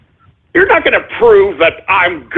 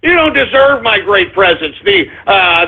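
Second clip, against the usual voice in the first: ordinary talk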